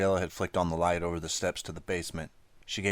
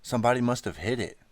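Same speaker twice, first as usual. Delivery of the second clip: crying out; 6 semitones higher, 4 dB louder than usual. A narrator's reading. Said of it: "she cried".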